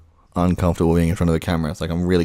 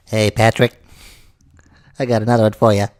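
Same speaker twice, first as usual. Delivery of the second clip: lightly done SpongeBob voice; cheerful cartoon sponge greeting; 3 semitones higher than usual. Shown as a demonstration. SpongeBob greeting Patrick, lightly voiced cartoon sponge. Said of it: "can I do SpongeBob".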